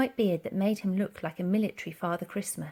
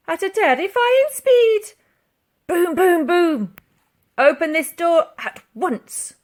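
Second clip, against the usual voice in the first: put-on old soldier voice; 9 semitones higher, 10 dB louder, slower than usual. Soldier voice and officers.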